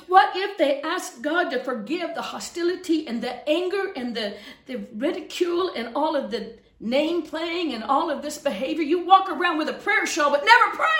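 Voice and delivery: mounting, urgent pulpit rebuke; impassioned preaching. Real, not acted. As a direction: build steadily to a loud, high finish. Preaching at full force.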